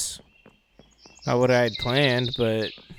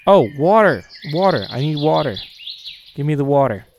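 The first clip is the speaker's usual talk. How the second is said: urgent, louder call for water; urgent demand; 6 dB louder than usual. Pretend. Player urgently wanting water in the game, loud demand.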